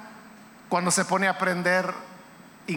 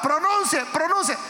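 urgent coaxing; 7 semitones higher than usual. Acted. Preacher acts out urging, higher pitched.

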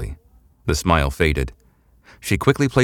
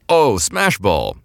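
light up at the game; big man thrilled to see his sport; excited delight; 4 semitones higher, much slower than usual. A narrator's reading.

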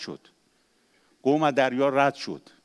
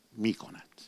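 whisper; 2 semitones lower, 8 dB quieter, slower than usual. Acted. Fearful end of Egyptian quote.

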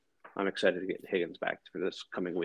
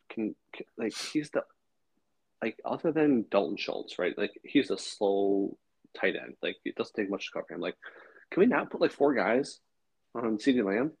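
exasperated rant; 4 semitones higher than usual. Retold or imitated. Venting about opponent's tight end.

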